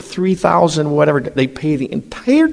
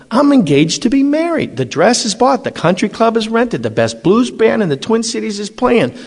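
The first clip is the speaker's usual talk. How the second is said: high, rushed listing of wedding plans; mock-panicked groom arguing with Jesus; frantic objection; 9 semitones higher than usual. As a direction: pile up excuses in a panic. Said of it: "And I'm going".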